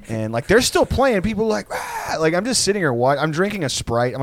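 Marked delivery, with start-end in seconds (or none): 1.7-2.2 thin, very high "Ah!"; mock crowd yell. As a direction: imitate rowdy drunks yelling, held back. Imitating yelling partygoers.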